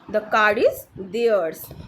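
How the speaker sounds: very slow, separated words; deliberate teacher's example reading; repeat-after-me model. Shown as a demonstration. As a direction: model it slowly for learners to copy. Teacher models sentence for repetition.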